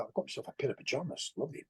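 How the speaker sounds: quick quiet aside. Real, not acted. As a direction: rattle it off under the breath. Aside about his pajamas.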